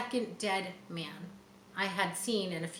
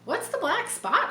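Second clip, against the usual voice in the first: put-on boy's question; 12 semitones higher, 4 dB louder than usual. A narrator's reading. Jim asks the captain.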